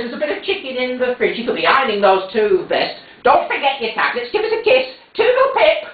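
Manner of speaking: fussing mother. Acted. Mother fussing while leaving.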